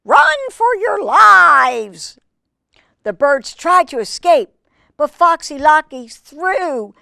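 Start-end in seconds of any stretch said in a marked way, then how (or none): 0.0-2.2 much higher, loud, slow scream of panic; panicked shriek. Acted. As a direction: scream a warning to the flock. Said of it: "she shrieked".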